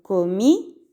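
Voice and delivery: slow "comí" with the final í stressed; teacher showing the accent on the í of "comí". Shown as a demonstration. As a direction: draw out "comí", landing on the í. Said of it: "avec un accent sur le i".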